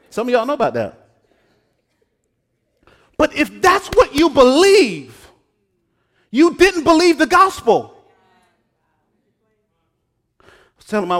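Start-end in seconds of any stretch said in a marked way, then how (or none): 3.2-8.0 loud, high, slow hammering of the point; preaching at full force. Real, not acted. Preacher confronting the congregation's legalism, loud and high. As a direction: drive it home to the pews.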